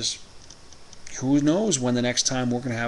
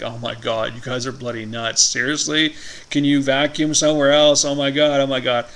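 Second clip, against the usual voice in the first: exasperated complaining; 2 semitones higher, 6 dB louder than usual. Retold or imitated. Quoting drunk Trevor.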